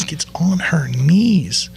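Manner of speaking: breathy mocking whisper, drawn out. Shown as a demonstration. Whispering to the audience about kneeling. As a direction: hush it like a shocked aside.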